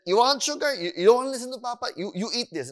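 high, fast retold scolding; mock-stern dad calling a bluff; scolding father. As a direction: challenge the child, pitch jumping up. Father retells scolding his toddler.

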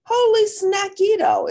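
loud high "Holy snackito!"; excited exclaim. Acted. Kitty boy exclaiming at a tasty fly, loud and high. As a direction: yell it out as the thrilled kitty. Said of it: "exclaimed the kitty boy".

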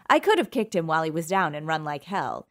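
plain reading voice

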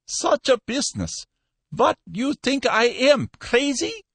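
high-pitched, incredulous outburst; indignant. A narrator's reading. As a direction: protest as if the caller were mad. Shopkeeper outraged at the demand.

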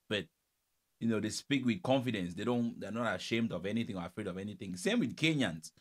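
ordinary talk

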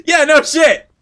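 loud, high, fed-up yelp; exasperated outburst. Real, not acted. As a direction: blurt it out in disbelief.

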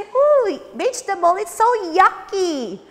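hammed-up picky child; mock child disgust. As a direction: play a picky kid. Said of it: "You might say".

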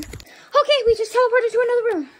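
high, loud and fast; excited. Pretend. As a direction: blurt it out, thrilled. Excited after teleporting.

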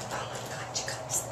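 breathless whisper to the viewers; awed whisper. Real, not acted. Host to viewers, overcome by the gift, whispering. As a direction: whisper it in awe.

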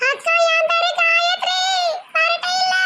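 high, slow sing-song call; new bride voice. Acted. New bride calling out to the first wife, high and drawn out. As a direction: stretch it out high and sweet.

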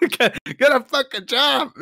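high, loud scornful yell; mock shout. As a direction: bark it at the lazy clown. Yelling at Pennywise.

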